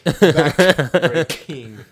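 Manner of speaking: Backbreaking said very slow and loud, each K punched, breaking into a laugh; slow, loud K demo. Shown as a demonstration. Asked by friend to say it, slow and loud, laughing. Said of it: "Say backbreaking".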